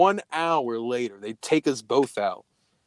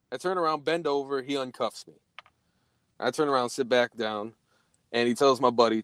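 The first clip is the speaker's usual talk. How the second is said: ordinary delivery, nothing marked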